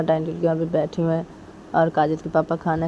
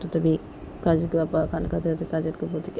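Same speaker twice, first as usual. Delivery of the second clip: plain reading voice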